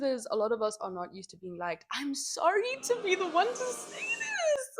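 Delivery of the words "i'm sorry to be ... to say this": higher, slow voice near tears; a touch of crying; tearful. Real, not acted. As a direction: apologize while choking up. Tearful hard truth.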